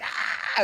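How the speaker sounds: loud, startled mock scream; sudden shriek, imitating scared guests; imitated scream. Guests hear a whisper in their ear, imitated scream.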